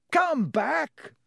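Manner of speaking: yell. A narrator's reading. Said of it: "Ron yelled after it".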